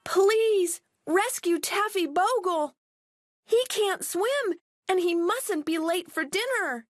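high desperate plea; pleading cry. Acted. Girls beg the fisherman to save their dog, high plea. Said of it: "cried Millie and Molly".

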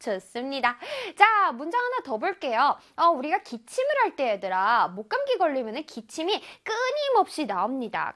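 ordinary talk

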